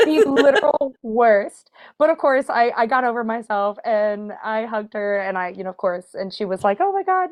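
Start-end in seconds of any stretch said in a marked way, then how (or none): 0.1-1.7 slow, louder, drawn-out "The literal worst"; dramatic emphasis. Real, not acted. Mortified emphasis. 6.8-7.3 much higher "Oh my God"; excited squeal. Retold or imitated. Idol recognises her.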